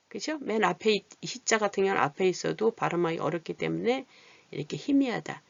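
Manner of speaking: normal talking voice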